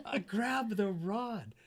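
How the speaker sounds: excited retelling. Retold or imitated.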